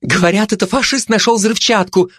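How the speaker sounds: high, excited gossip; high-voiced busybody; eager gossip. A narrator's reading. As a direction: spill the rumour eagerly. Gossip runs up with news.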